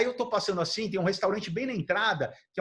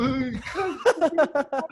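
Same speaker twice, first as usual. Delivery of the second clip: laughing awe; 8 semitones higher, much slower than usual. Retold or imitated. Awed reaction to seeing Rodman.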